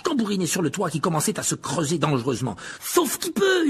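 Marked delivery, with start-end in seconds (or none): 2.8-3.7 high-pitched panicked yell; frantic, very high shout; howled alarm. A narrator's reading. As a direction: yell it as everyone must flee. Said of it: "hurla Ron".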